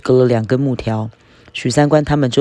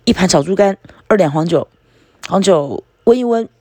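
bang the table and call out, copying the others; proud shouted order; 6 semitones higher than usual. A narrator's reading. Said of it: "手拍着桌子喊道".

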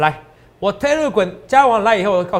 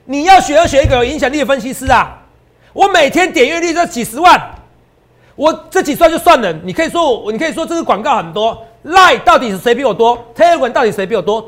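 much higher, louder, furious outburst; real angry rant; 7 semitones higher, 6 dB louder than usual. Real, not acted. Host furious at impostors cheating his fans, shouting angrily. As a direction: let the anger boil over, shouting. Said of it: "我真的很气啊".

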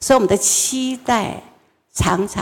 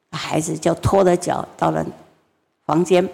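normal talking voice

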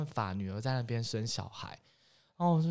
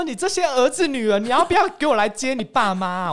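real angry complaint, much higher and louder; exasperated outburst; 11 semitones higher, 10 dB louder than usual. Real, not acted. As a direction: scold them with real outrage, voice up high. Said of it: "我真的很气耶".

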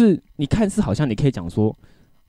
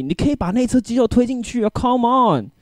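exasperated rant; 9 semitones higher than usual. Retold or imitated. Ranting about coworkers blocking hallway.

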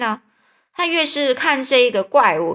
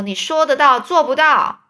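put-on higher, louder sneering boy; taunt; 5 semitones higher, 5 dB louder than usual. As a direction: throw it back at him. Rival taunts Tom.

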